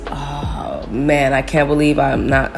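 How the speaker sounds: ordinary delivery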